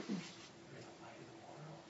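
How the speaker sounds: breathless, hushed wonder; soft unvoiced whisper of discovery; awed whisper. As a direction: whisper it as if it just dawned on you. [name] kinda lights up.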